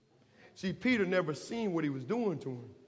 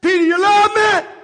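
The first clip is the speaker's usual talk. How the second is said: higher, shouting Jesus asking Peter; shouted question; 14 semitones higher, 15 dB louder than usual. Acted. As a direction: cry the question out to the rafters. Jesus asks a third time, shouted.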